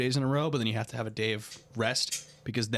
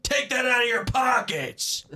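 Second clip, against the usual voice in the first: high, slow mock shout at a shoplifter; imitating shouting; 10 semitones higher, 7 dB louder, slower than usual. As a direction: bark it like a petty store vigilante. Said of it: "He's like".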